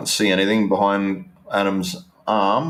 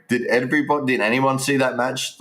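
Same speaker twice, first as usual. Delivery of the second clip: ordinary talk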